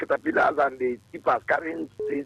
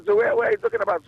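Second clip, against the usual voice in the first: heated; 5 dB louder than usual. Real, not acted. Heated caller protesting.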